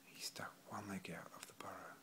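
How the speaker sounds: usual speaking voice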